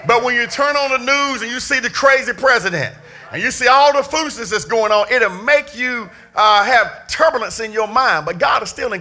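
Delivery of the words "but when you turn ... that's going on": ordinary talk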